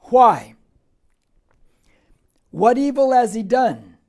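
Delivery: loud, punched challenge; forceful, indignant questioning; Pilate demanding. Acted. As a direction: fire the question out at full voice. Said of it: "here's Pilate's next question".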